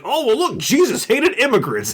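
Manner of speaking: a hint of smug mock piety; mock pious bigot. Mocking Bible-quoting bigots.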